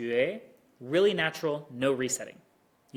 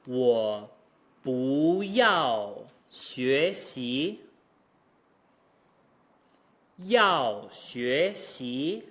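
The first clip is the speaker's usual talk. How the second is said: 我不要学习 drawn out very slowly, one syllable at a time; 2 semitones lower, much slower than usual. Shown as a demonstration. Teacher breaking the sentence into slow syllables. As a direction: stretch each syllable out slowly. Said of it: "run by these syllable by syllable".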